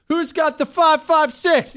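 hammed-up high, drawn-out call; over-eager prepper. Prepper opening a neighbourhood meetup, hammed-up eagerness. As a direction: call it out like an overzealous militia buddy. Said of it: "You don't have to lead with".

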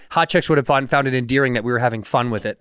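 usual speaking voice

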